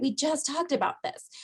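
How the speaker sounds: a tired family reminder; mock impatience. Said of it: "I say that in the course of a day".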